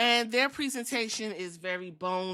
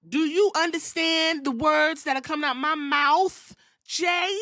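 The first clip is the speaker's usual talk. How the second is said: mock yell; 7 semitones higher, 5 dB louder than usual. Acted quarrel quote, raised voice.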